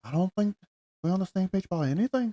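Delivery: doing a bad Irish accent on purpose; mock Irish accent. A friend teases by repeating Dempsey's line in a mock Irish accent. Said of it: "what is this accent?".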